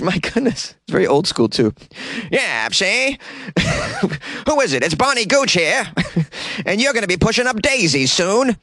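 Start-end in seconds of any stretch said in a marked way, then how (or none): 2.3-8.6 put-on high old-timey gangster voice with cackles; hammed-up mobster granny. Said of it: "spitting out this old gangster lingo".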